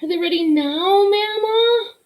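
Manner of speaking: put-on high, pleading little boy voice; a small boy begging at the oven; eager child. Little Buddy pleading with Mama at the oven.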